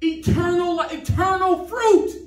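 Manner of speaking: high, ringing pulpit proclamation; climactic preaching. Real, not acted. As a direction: build the promise with fervent emphasis.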